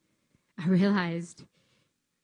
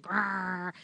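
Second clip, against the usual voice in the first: mock growl. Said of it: "them big old muscles, like".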